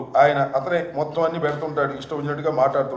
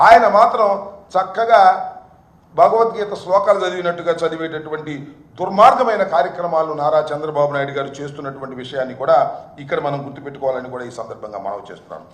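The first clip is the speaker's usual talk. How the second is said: usual speaking voice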